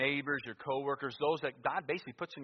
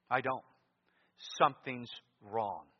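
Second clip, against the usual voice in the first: normal talking voice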